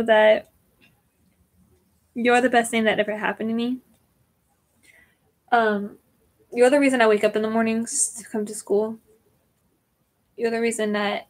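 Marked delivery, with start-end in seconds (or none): none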